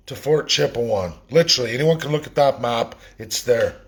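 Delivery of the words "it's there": normal talking voice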